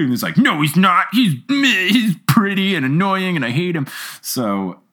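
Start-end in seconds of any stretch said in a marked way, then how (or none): 0.4-3.9 jealous sulky mockery. Mocking Harry's sudden jealousy.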